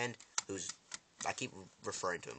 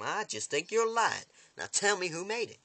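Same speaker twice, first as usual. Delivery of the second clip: high, raised-voice interrogation; accusing demand; 10 semitones higher, 5 dB louder than usual. Retold or imitated. Villain doubts her confession, accusing demand. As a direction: push it up high, mock-threatening.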